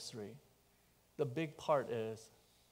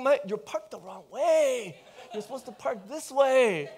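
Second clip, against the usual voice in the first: mock irritated thought; 10 semitones higher, 11 dB louder than usual. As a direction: scold the wrong-way driver half in jest. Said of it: "I'm already thinking".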